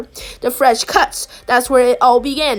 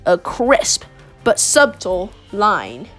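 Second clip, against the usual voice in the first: "crisp but subtle line" drawn out low, with pauses; slow, savoured repeat; 6 semitones lower, slower than usual. A narrator's reading. Narrator savouring all you'll ever need, slow and low.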